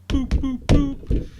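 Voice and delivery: high, loud, cartoonish button beeps; imitated beeps. Shredder pressing the teleporter buttons, high loud beeps. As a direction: chirp out the boops like a gadget, high and loud.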